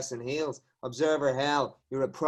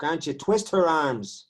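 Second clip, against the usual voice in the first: ordinary delivery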